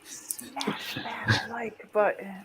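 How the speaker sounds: slow playful whisper. Pretend. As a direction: breathe it out slowly like a secret. Jokey plea to viewers for likes.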